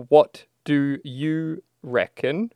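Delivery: Aussie accent, drawn out; slow drawled Aussie. Shown as a demonstration. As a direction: stretch it slowly in broad Aussie. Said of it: "we say it as one word".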